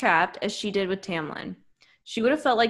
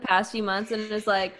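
usual speaking voice

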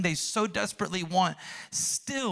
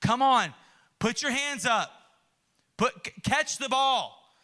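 acted exasperated dad, pitched up; frustrated dad; 7 semitones higher than usual. Dad teaching his young son to catch, frustrated. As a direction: urge him on, out of patience. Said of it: "he's frustrated again".

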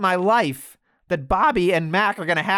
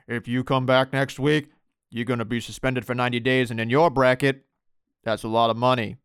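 a dropped-low voice handing out a suspension; deep Adam Pearce impression; 7 semitones lower than usual. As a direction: drop it low and lay down the law. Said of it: "Why does Adam Pearce have such a deep voice?".

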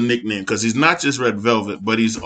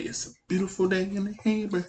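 high, soft mock neighborly voice; Mister Rogers catchphrase; 7 semitones higher, 10 dB quieter than usual. Host reading out an imagined shirt, mock Mister Rogers voice. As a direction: recite it softly up high, imitating a gentle greeter. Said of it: "Mister Rogers shirt".

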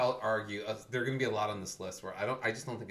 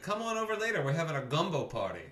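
much higher and louder, hammed-up character voice; mock TV cop; 6 semitones higher, 4 dB louder than usual. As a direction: send up the cheesy show's hero. Mocking K-ville character's line.

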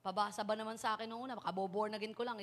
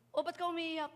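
policeman; 5 semitones higher than usual. Acted. Preacher acts policeman and lost child.

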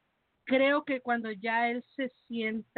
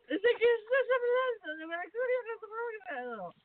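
words squeezed through laughter, high; laughing; 11 semitones higher than usual. Real, not acted. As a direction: laugh while talking.

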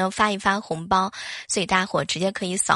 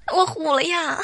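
embarrassed laugh; 6 semitones higher than usual. Real, not acted. Host embarrassed after spoiling guess.

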